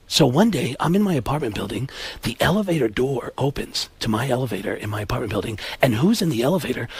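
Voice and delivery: low, quiet raconteur; Alec Baldwin. Acted. Baldwin telling of the elevator encounter, hushed low voice. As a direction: tell it softly, deep and confidential. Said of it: "So Alec Baldwin says".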